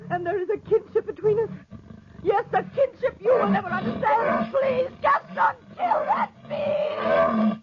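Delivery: rising frantic cry, last words stretched; desperate plea. Acted. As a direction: beg him with everything she has. Desperate plea to kill the panther.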